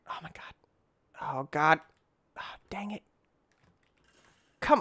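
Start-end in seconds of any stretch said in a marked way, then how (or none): 0.1-3.0 breathless whispered oh God. Real, not acted. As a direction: whisper it in dismay. Whispering after fall.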